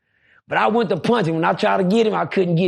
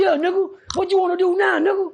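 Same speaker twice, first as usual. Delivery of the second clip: pitched-up mocking challenge; high taunting threat; 12 semitones higher than usual. Young inmate threatening the narrator, a high taunt. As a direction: taunt him like a cocky kid. Mutt threatening him, voiced.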